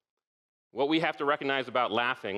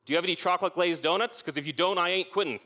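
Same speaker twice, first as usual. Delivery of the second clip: raised, higher voice, calling out; yelled quip; 6 semitones higher than usual. Retold or imitated. A SEAL trainee in cold surf calls out, raised and high. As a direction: pipe up high with a cheeky yell. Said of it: "He yells".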